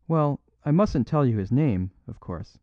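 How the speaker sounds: ordinary delivery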